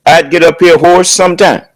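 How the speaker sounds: usual speaking voice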